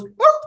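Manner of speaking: a high, loud playful dog bark; big rising storytime woof. Acted. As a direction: bark it out like the pup Blue. Dog Blue barks in story.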